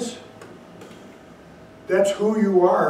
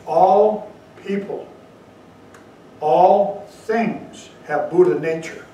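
all stressed, slow and louder; emphatic; 4 dB louder, slower than usual. Acted. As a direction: lean on all, slow and weighty. Preacher stresses the core teaching.

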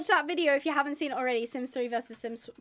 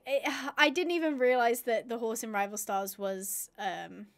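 ordinary talk, nothing marked

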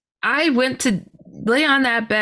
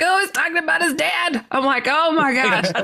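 dawning realisation; 9 semitones higher than usual. Retold or imitated. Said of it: "And I'm like".

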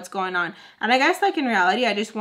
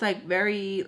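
ordinary talk, nothing marked

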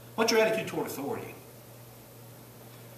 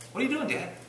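put-on child's question; 7 semitones higher than usual. Child walks in on prayer.

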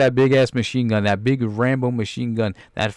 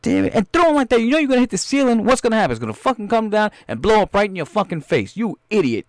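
high, loud outraged rant; angry director's tirade; 10 semitones higher, 3 dB louder than usual. Acted. Director berates his actor over a limp grenade throw, furious. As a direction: chew out a hopeless actor.

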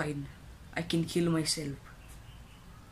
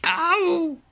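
loud pained yelp; 12 semitones higher, 7 dB louder than usual. Acted. Pain from jar.

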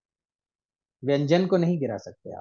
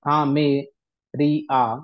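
syllable by syllable; 2 semitones lower, slower than usual. Shown as a demonstration. Teacher breaks the line into syllables, slow and deliberate. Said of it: "ऐसे चार चार के units में बता रहा हूं मैं".

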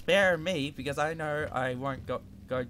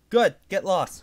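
relieved outburst; 5 semitones higher, 9 dB louder than usual. Real, not acted. Relief after the monster leaves.

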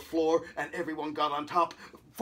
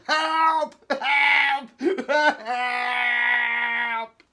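high, loud repeated cries for help; trapped, wailing shouts; yelled; 7 semitones higher, 10 dB louder, much slower than usual. A narrator's reading. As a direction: holler for rescue, stretching out the last one. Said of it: "bounced up and down ... and yelled".

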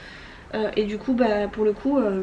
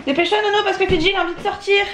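high, raised call to hurry; 9 semitones higher than usual. Real, not acted. Hurrying son, dog waiting.

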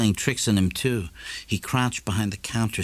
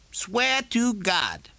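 much higher, slow, grinning vow; Morrison's vow; 12 semitones higher, much slower than usual. A narrator's reading. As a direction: swear it with a grin. Morrison voiced by narrator.

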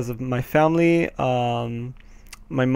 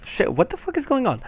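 startled outburst; 10 semitones higher, 4 dB quieter, faster than usual. Real, not acted. Gamer startled by something in the parkour map, high and quick. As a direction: blurt it out high and fast. Sudden trouble in the game.

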